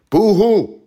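high, loud fake sobbing boo-hoo; sarcastic crybaby wail; mock boo-hoo. Mocking people upset that summer is over, fake boo-hoo.

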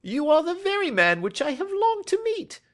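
very high, loud eager little-man voice; excited little fellow's cry. A narrator's reading. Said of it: "cried the little fellow".

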